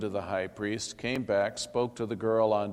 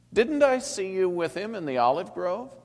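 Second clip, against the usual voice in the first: challenging; 10 semitones higher, 5 dB louder than usual. A narrator's reading. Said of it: "challenged him".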